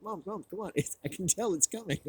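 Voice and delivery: high-pitched pleading to mom; eager urging. Retold or imitated. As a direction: nag her to look, all excitement. Urging mom to watch the screen.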